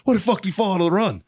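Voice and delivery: fast, high-pitched baffled demand; mock indignation. Gunny baffled why the fast kid fell out, mock indignant. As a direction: imitate gunny demanding an explanation.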